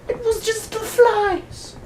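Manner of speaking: very high, slow squeaky take on "It was just the flies"; mock squeaky official. Official insists it was only flies, high squeaky mock voice. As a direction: squeak it out dismissively. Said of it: "the other guy's like".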